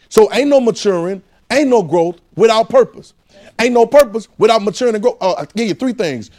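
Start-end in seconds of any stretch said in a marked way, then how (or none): 0.1-5.2 higher, louder preaching; building sermon call. Acted. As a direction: drive the point home to the church. Preaching at full force.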